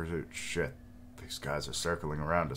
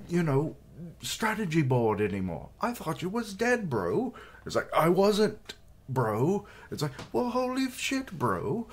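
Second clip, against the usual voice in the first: mock flustered general. Gamer imitating Osen freaking out, in a squeaky high mock voice. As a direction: do a panicking bro general. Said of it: "He's like".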